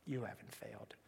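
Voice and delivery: low, very soft reassurance in a whisper; hushed, intimate murmur. Retold or imitated. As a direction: whisper it privately, as comfort. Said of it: "in a whisper".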